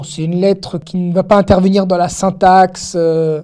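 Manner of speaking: ordinary delivery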